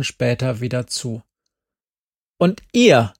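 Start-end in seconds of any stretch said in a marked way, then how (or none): 2.4-3.1 loud, higher, stern demand; stern woman's voice. A narrator's reading. Stern mother demands from the jeep which girl is Geraldine. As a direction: bark the question at the kids. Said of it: "sagte die Frauenstimme erneut".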